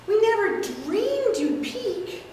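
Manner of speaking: a sweet aunt gently scolding; elderly aunt. Acted. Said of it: "take several characters".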